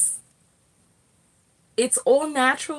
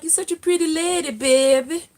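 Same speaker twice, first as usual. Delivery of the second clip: put-on Geechee accent; 5 semitones higher, 4 dB louder than usual. Imitating the sweet Geechee accent of South Carolina locals. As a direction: mimic the Gullah Geechee lilt sweetly. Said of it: "They sound so, the accent is so cute".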